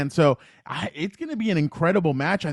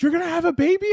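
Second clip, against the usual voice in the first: imitating Asuka, much higher and a bit louder; surprised Asuka; 14 semitones higher, 4 dB louder, faster than usual. Retelling the Asuka and Becky segment, he gives a high surprised squeal. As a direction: squeal the question in mock surprise. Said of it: "And Asuka goes".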